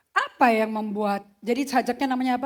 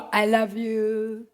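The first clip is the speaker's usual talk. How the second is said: slow tender declaration; 7 dB louder, much slower than usual. Pretend. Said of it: "kalau gua sih".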